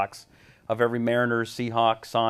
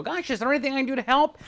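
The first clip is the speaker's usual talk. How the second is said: put-on much higher, eager young voice; eager naive kid; 13 semitones higher, faster than usual. Teen offering help to angry newsman, eager high voice. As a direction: play the wide-eyed sixteen-year-old.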